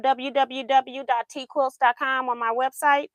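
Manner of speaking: usual speaking voice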